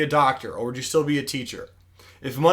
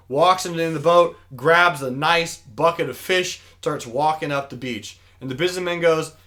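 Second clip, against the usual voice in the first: ordinary delivery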